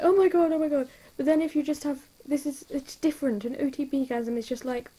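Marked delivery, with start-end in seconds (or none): 0.0-0.9 loud, breathless oh my god, higher; loud giddy gasping squeal; excited fangirl squeal. Retold or imitated. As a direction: gush like a fan seeing their ship. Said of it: "you're just like".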